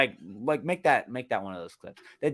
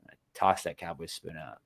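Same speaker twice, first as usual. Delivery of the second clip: ordinary talk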